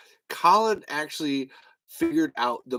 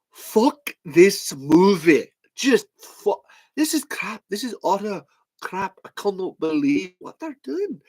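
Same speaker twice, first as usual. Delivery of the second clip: high-pitched, indignant Belfast rant; mock Belfast outrage, pitched up; Belfast accent; 7 semitones higher, 3 dB louder than usual. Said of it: "he said he was from Belfast".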